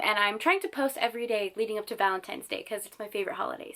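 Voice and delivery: usual speaking voice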